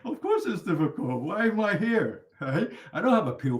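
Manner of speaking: loud, laughing rhetorical push. Real, not acted. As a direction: laugh it off, then boom out the question. Teacher laughs at student's objection.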